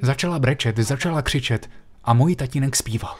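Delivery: ordinary talk, nothing marked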